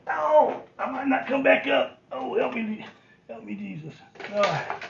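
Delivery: high, loud cry going down; hammed-up plea for help; mock panic. Going down to the floor.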